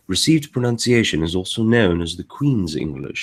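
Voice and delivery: proper Queen's English accent; RP, every syllable precisely dictated; received pronunciation. Shown as a demonstration. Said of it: "very proper, and every syllable is, is precisely".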